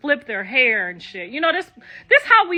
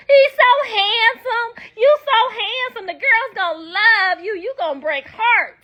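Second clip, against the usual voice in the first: doting mother; 8 semitones higher than usual. Retold or imitated. Young mother fussing over her handsome baby son, high cooing. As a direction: coo over the baby boy.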